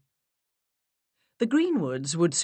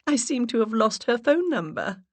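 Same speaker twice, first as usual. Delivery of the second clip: high breathy put-on voice; 7 semitones higher than usual. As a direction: voice raised an octave, stronger English accent. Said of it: "I raised my voice an octave".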